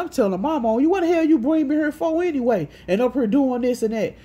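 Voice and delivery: loud, high imitation of a complaining brother; indignant tattling. Brother threatening to tell Mama, indignant. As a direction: protest loudly like a sibling telling on someone.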